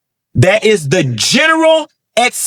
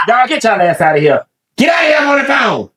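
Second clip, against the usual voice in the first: real shout, much higher; shouting people out; 6 semitones higher, faster than usual. Real, not acted. Vlogger shouting at people in the room to get out. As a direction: yell at them to get out while on the phone.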